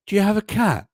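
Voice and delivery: put-on high, eager little-kid question; a child on Zoom interrupting the teacher; imitating a six-year-old. Teacher mimicking a pupil's cat question, high and childlike. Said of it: "they'll be like".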